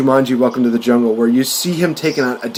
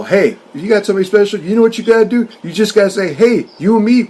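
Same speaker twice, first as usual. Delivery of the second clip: high, animated coaching pitch; acted eager advice; 8 semitones higher, faster than usual. Actor advises his buddy on asking someone out, eagerly. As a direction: urge a buddy on with rising energy. Acting out movie advice scene.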